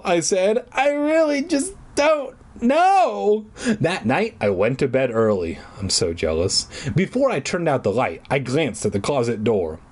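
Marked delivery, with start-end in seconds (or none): 0.7-3.5 high, drawn-out distressed reply; hammed-up slow, high distress. A narrator's reading. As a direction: stretch it out, high and upset. Repeats distressed reply to friend's question.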